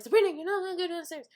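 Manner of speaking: high, loud and rapid; bossy scolding. Retold or imitated. Brother chasing sister down the stairs, bossy scolding. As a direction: rattle it off as a frantic telling-off.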